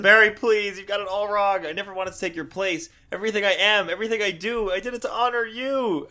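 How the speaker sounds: high, earnest retold plea; acted pleading. Wally begging Barry to believe him, high acted plea.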